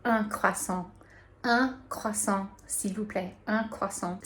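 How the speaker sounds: "Un croissant" with a nasal un and a French r, slowly; careful French demo of "un croissant". Shown as a demonstration. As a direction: model "Un croissant, s'il vous plaît" like a teacher. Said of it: "you're gonna say".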